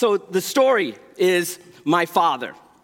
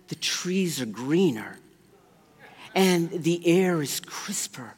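hushed awe; 2 semitones lower, 5 dB quieter than usual. Retold or imitated. Convert describes his new life.

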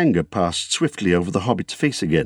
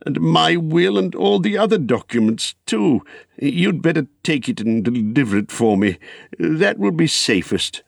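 higher-pitched, busy hobbit; Bilbo; 8 semitones higher than usual. Acted. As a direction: hand over instructions in a higher voice.

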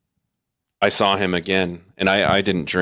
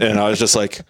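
ordinary delivery

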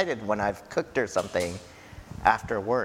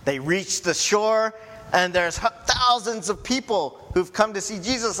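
ordinary talk, nothing marked

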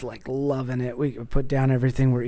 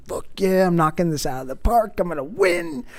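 pitched way up, gloating like a winner; mock triumph; 5 semitones higher than usual. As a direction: crow it like you just won. Dad's triumphant inner voice.

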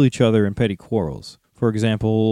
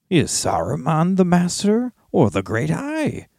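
higher, sly evil orc; 7 semitones higher than usual. A narrator's reading. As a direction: needle him with a sly evil voice. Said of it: "said the evil voice".

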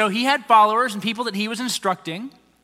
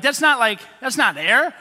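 normal talking voice

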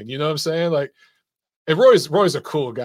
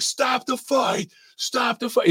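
higher pitched, slow retold yell; mild mock yell at the ref, higher and drawn out; mock scream; 8 semitones higher, slower than usual. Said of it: "He was screaming".

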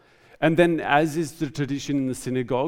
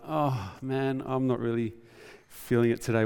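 reluctant churchgoer; 4 dB quieter than usual. Acted. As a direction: play someone not really feeling it. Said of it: "other times it might be like".

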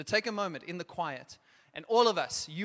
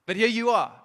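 rallying shout; 4 semitones higher, 10 dB louder than usual. Real, not acted. Rallying youth at the front.

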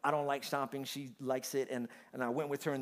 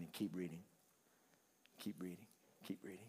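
soft, lower repeated plea; quiet refrain; 7 semitones lower, 14 dB quieter than usual. Acted. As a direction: draw them in gently.

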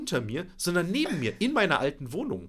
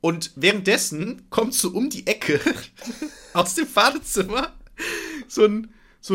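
laughing; 8 semitones higher, 5 dB louder than usual. Real, not acted. Laughing while telling.

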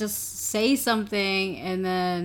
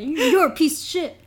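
higher, raised-voice taunt; mock insult; 6 semitones higher than usual. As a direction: toss out a mock insult, pitched up. Retelling a show's heckled insult.

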